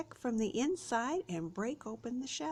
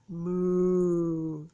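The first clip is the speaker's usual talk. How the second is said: cow moo; 2 semitones lower, 7 dB louder than usual. Shown as a demonstration. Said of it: "It's not the cow".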